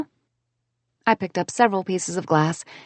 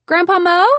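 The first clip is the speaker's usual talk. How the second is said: high loud call across the house; 11 semitones higher, 7 dB louder than usual. A narrator's reading. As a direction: call him from the kitchen. Calling to grandpa.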